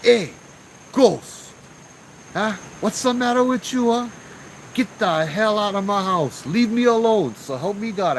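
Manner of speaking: loud, high-pitched telling-off; retold yelling. Uncle orders the ghost out of his house, mock furious yell. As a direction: yell it like chasing off a pest. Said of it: "I went, yell at him".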